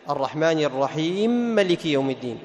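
"الرحيم مالك" read with the two m's fused into one doubled m; merged mim. Shown as a demonstration. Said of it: "يدغمها في الميم الثانية".

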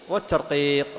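normal talking voice

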